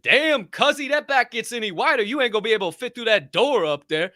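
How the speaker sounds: high, loud playful hype; hyped compliment. Retold or imitated. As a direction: shout a cheeky compliment across a parking lot. Retold loud compliment to stranger.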